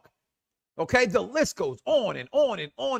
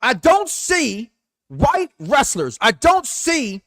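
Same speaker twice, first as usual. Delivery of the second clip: slow, louder, high, punching don't; emphatic slow stress; 5 semitones higher, 6 dB louder, much slower than usual. Real, not acted. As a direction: lean hard on every word. Heated rant about race.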